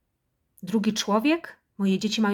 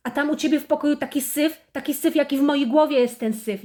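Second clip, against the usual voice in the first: mother yelling; 3 semitones higher than usual. Acted. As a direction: berate the clumsy child from above.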